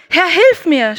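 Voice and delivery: loud, high cry of panic; cry for help. Acted. Peter sinking cries out to the Lord, loud panic. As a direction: shout it as you sink. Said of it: "schrie er".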